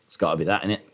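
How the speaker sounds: usual speaking voice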